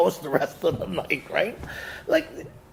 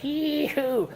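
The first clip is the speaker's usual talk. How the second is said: a high, drawn-out "Yee-hoo"; party whoop; 9 semitones higher, 5 dB quieter than usual. Retold or imitated. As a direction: whoop like the party just started.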